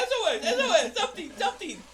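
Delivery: high, very loud panicked shouts; mock SOS. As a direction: keep yelling for rescue as a joke. Co-host continues joke distress call.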